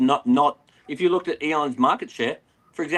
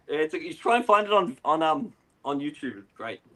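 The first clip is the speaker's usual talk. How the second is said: normal talking voice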